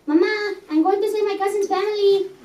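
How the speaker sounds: put-on high, loud kid-cousin voice with a Spanish lilt; son calling mom. Penboy calls to his mother.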